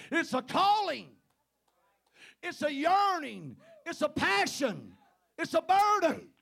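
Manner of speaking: loud, high, rising preacher's cry; preaching at full force. Acted. Preacher crying out about God's calling, loud and high. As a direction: pound each phrase to the rafters.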